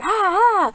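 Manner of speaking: high, drawn-out "ah" screams; mock scream. Friends see a scary man show up, high mock screams. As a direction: imitate frightened screaming at a very high pitch. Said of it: "then they started screaming".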